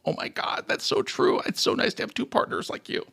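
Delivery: soft, whispery heartfelt gushing; hushed sincerity. Shown as a demonstration. Host showing co-host how the praise was meant, hushed. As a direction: whisper it as if deeply touched. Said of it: "that was me saying".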